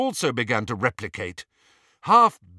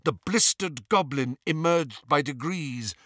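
plain reading voice

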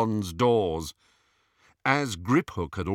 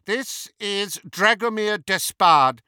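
slow, high, loud grand introduction; Bellatrix; 11 semitones higher, 7 dB louder than usual. A narrator's reading. Disguised Hermione introducing her foreign friend, haughty. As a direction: present him in a haughty high witch voice.

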